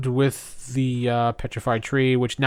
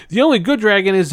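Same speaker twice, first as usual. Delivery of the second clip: snide remark; 7 semitones higher, 6 dB louder, faster than usual. Retold or imitated. Eric's remark quoted.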